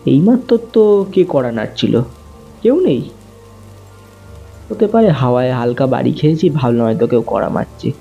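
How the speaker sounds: usual speaking voice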